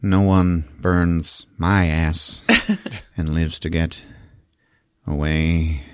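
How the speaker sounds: slow, low, halting menace; vengeful monster. Acted. Monster threatening the escaping heroes, slow low voice. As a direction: draw out the threat with heavy pauses. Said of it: "Porno's like".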